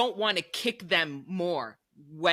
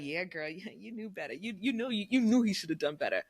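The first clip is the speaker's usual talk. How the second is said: softer, hushed mock gloat; 2 semitones lower, 5 dB quieter, faster than usual. Wife gloating softly to her husband. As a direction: murmur it like a smug friend.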